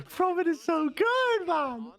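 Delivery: high, slow, amazed; awed praise. Real, not acted. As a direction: gush with awe. Praising after the clutch.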